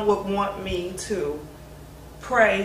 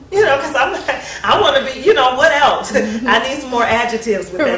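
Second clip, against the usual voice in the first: laughing, louder, playful complaint; 4 semitones higher, 7 dB louder than usual. Real, not acted. Daughter jokes to interviewers about wanting more compliments, laughing. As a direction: laugh through it, teasing for more praise.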